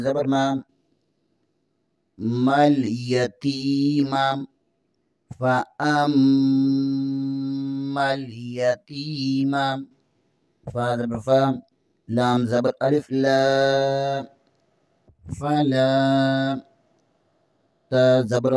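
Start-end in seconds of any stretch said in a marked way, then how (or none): 2.2-9.8 long, drawn-out Quranic chant; slow recitation. Shown as a demonstration. Teacher models the verse for students, slow chant. 15.3-16.5 drawn-out Quranic recitation; slow recitation. Shown as a demonstration. Teacher models the word for students, slow chant.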